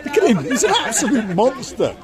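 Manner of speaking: high, loud, teasing wonder; mock awe. Host teasing the big tourist, high excited mock awe. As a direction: marvel at the big man like a showman.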